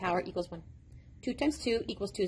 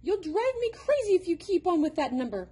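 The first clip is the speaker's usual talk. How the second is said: exasperated; 8 semitones higher, 4 dB louder than usual. A narrator's reading. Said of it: "Robert cried".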